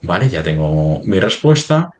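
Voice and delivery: ordinary delivery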